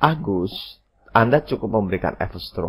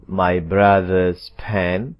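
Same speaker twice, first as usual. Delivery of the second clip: "my brother's pen" drawn out word by word; very slow model phrase; slow careful example; 3 dB louder, much slower than usual. Shown as a demonstration. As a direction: stretch "brother's" so its ending stands out. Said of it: "Amati posisinya".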